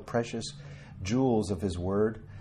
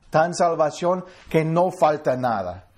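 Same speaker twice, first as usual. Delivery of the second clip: higher, louder, joyful Spanish line; excited Spanish; 6 semitones higher, 6 dB louder than usual. Real, not acted. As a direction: cheer it out in Spanish.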